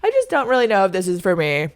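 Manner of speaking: airy high oh yeah, faking calm; mock nonchalant reply; pretend chill. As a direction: act casual to hide the panic. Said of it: "pretend act so chill".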